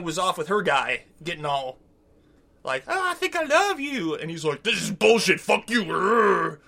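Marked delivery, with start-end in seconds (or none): 2.9-4.1 high put-on lovey voice; mock lovesick. Mocking a castmate's love confession, high lovesick voice. 4.6-6.6 cartoon rage ending in a growl; mock rage. Retelling how he rages out over her, mock-angry growl. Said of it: "He does rage out".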